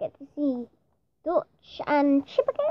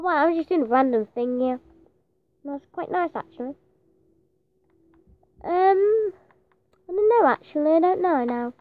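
usual speaking voice

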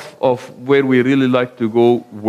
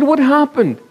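higher, puzzled question from the wife; surprised wife; 10 semitones higher than usual. Retold or imitated. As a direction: ask in surprise, pitched up like the wife. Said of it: "And she said".

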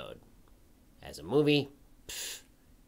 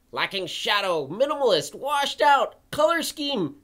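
mounting disdain; 8 semitones higher, 4 dB louder than usual. Acted. Listing complaints in rising outrage.

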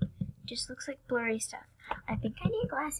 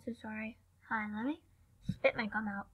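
ordinary talk

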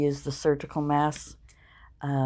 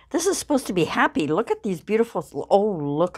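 much higher and fast, snapping back to cheerful; forced cheer; 5 semitones higher, 3 dB louder, faster than usual. Real, not acted. Vlogger to viewers after a sad story, forcing cheer quickly. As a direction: shake off the sadness with a bright rush. Pulls herself out of sadness.

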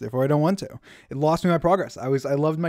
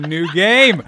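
loud slow yelling; frustrated shout; 3 semitones higher, 10 dB louder than usual. Real, not acted. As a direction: yell it in disbelief. Same words, now yelled.

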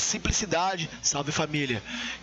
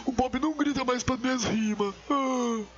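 imitating the rapper's complaint, pitched higher; higher mocking quote of a grumbling contestant; 5 semitones higher than usual. Host mimics Mike complaining about his rhymes, mock complaint. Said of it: "Depois você fala".

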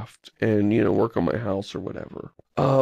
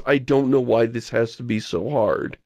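usual speaking voice

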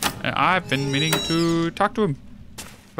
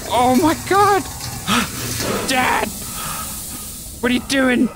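panicked shrieks; 11 semitones higher than usual. Real, not acted. Gassed by his father, panicked screaming. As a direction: scream in real shock.

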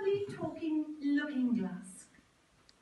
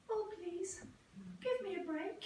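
pleading; 7 semitones higher, 6 dB quieter than usual. A narrator's reading. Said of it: "She cried".

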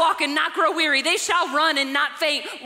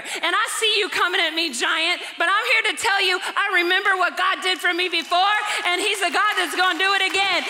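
louder, higher, driving sermon build; rally the church against the giant; preacher defying the giant, building to amen; full-force preaching; 4 semitones higher, 3 dB louder than usual. Acted.

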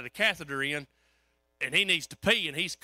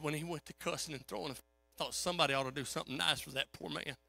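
hushed lady; 3 semitones lower, 8 dB quieter than usual. Retold or imitated. Lady whispering in hallway.

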